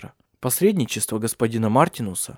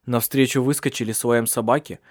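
ordinary delivery, nothing marked

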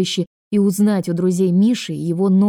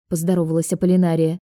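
usual speaking voice

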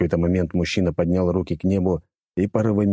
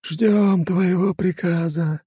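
high, slow eerie djinn voice; otherworldly servant awaiting orders; grave-like voice; 11 semitones higher, much slower than usual. Acted. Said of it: "за могильным голосом".

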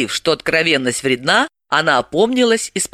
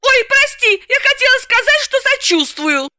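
Galina backpedalling. Acted. Galina hastily calming angry Dalila, high voice. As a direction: take it back quickly but still joking.

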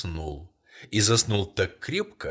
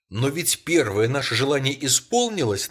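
insisting; 5 semitones higher, 6 dB louder than usual. A narrator's reading. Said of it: "настаивала старуха".

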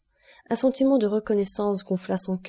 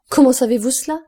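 higher, louder astonished question; 5 semitones higher, 8 dB louder than usual. A narrator's reading. Concierge amazed that the count guessed his find, astonished. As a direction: blurt it out, amazed. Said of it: "demanda le concierge avec étonnement".

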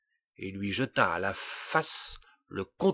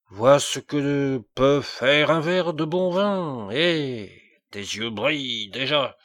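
taunting; 4 semitones higher, 6 dB louder than usual. A narrator's reading. King taunts dwarf with wine.

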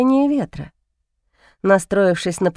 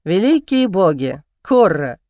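chuckling; 4 semitones lower than usual. Acted. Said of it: "усмехнулся Альсар".

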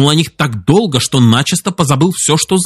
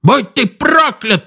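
slow, very high squeaky curse; squeaking; 11 semitones higher, much slower than usual. A narrator's reading. Chief curses Duncan to his face, squeaking. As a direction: curse in a piping furious squeal. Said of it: "пропищал он".

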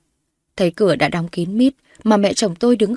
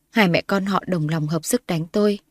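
usual speaking voice

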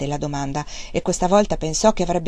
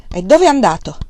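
sharp, louder demand; brusque; 2 semitones higher, 7 dB louder than usual. A narrator's reading. Father demanding where his eldest son went, brusque and loud. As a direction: snap the question at him. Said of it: "esclamò bruscamente".

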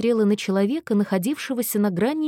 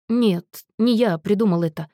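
ordinary delivery

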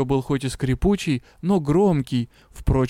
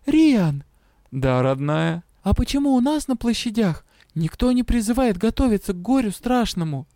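heroine calling; 6 semitones higher than usual. A narrator's reading.

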